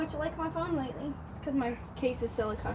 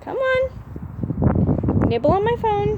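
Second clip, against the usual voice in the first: coaxing a wild bird; 8 semitones higher, 9 dB louder, much slower than usual. Real, not acted. Coaxing bird onto phone.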